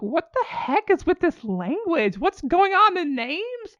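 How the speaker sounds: high, loud, drawn-out exasperation; bewildered wail at a confusing book; baffled outburst. Retold or imitated. Retells own baffled outburst to friend.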